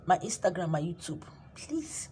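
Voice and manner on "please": whispered soft plea. Real, not acted. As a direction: breathe it to the listener. Quiet plea to buy book.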